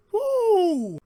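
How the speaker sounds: loud, high whoop of amazement; excited whoop. Real, not acted. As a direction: let out a big thrilled woo. Reacting to the shocking scene.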